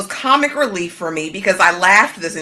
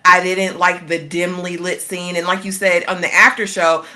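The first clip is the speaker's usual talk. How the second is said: ordinary talk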